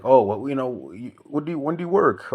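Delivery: high, light mocking questioner; lightly voiced nosy asker; mock small talk. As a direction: ask like a nosy acquaintance.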